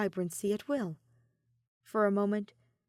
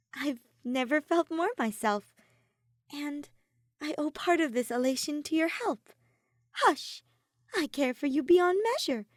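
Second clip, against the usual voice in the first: higher, louder, heartfelt; Soleil's heartfelt voice; 7 semitones higher, 4 dB louder than usual. A narrator's reading. Soleil thanking Ophelia for her help, higher and heartfelt. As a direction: pour out gratitude.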